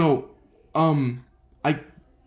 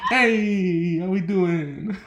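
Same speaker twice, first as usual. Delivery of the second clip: higher, jokey drawn-out hello; mock breezy greeting; 3 semitones higher, much slower than usual. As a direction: greet them breezily, half laughing. Breezy greeting amid sobbing family.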